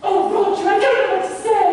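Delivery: high, fluttery fake lady voice; mock lady's voice. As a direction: gush like a flustered lady. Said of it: "imagine you are Miss Sarah Hampton".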